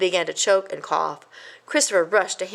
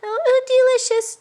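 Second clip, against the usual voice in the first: high, louder, strangled praise; choked; 6 dB louder than usual. A narrator's reading. A mouse chokes down sawdust, high and strained. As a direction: choke the word out. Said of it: "choked Christopher".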